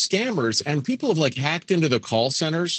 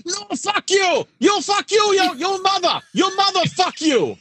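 very high, shouted mock rant with Indian accent; crazed angry scammer; 12 semitones higher, 4 dB louder than usual. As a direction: imitate a scammer losing it, yelling shrilly high. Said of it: "the guys go crazy going".

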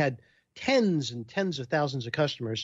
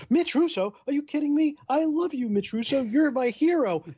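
delighted fan pitched way up; thrilled fan; 12 semitones higher than usual. Retold or imitated. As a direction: squeal it like meeting your hero. Excited fan voiced in story.

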